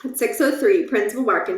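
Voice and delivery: ordinary delivery, nothing marked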